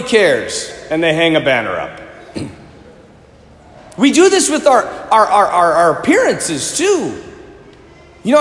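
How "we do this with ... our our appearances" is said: ordinary delivery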